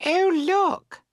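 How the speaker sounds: put-on high, loud startled girl; surprised. A narrator's reading. Girls see the boat adrift, surprised. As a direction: call out in high-voiced surprise. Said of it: "Then they stand up and are surprised".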